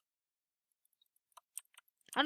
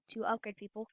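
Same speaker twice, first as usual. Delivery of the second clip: whispered trailing words; 4 semitones lower, 7 dB quieter than usual. Real, not acted. Gamer trailing into a whisper explaining the tips. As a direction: drop to a whisper at the end.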